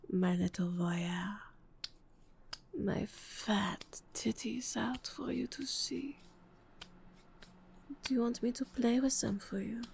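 ordinary talk, nothing marked

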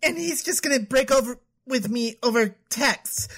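normal talking voice

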